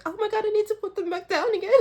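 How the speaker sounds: high, fast, flustered gasp; mock flustered outburst. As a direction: rattle it off like a panicked reader. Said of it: "and then being like".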